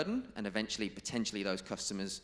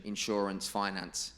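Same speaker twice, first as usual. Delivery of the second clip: ordinary talk, nothing marked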